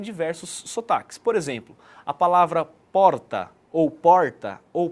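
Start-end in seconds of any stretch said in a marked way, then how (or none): 2.9-3.5 "porta" with a curled retroflex R; caipira R. Shown as a demonstration. Teacher showing four accents, "porta" with caipira R. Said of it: "que é o R caipira". 3.9-4.6 "porta" with one quick tongue tap for R; São Paulo tapped R. Shown as a demonstration. Teacher showing four accents, "porta" with tapped R. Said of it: "que é o R da capital".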